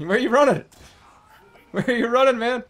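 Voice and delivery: high, loud laughing yell after him; laughing shout. Real, not acted. Chasing fleeing enemy.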